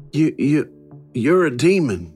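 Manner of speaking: stammering in fear; frightened stutter. Acted. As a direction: stumble over the words, scared. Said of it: "Alvin stuttered".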